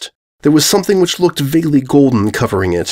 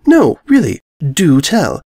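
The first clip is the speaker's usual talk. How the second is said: prim mare; 5 semitones higher, 3 dB louder, slower than usual. A narrator's reading. Mare presses, high voice.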